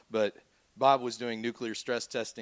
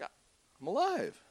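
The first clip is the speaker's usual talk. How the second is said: higher and slow, in wonder; awed disbelief; 8 semitones higher, much slower than usual. Retold or imitated. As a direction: stretch it out in disbelief. Marvelling quote after surviving.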